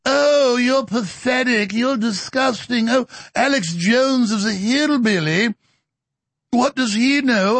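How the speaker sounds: high, slow, whiny-mocking imam voice; mocking imam impression. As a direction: imitate the imam as drawn-out, hammed-up scorn. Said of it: "So here he is with his imam".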